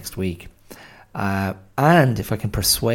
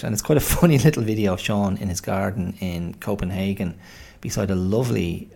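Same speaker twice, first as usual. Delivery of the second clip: usual speaking voice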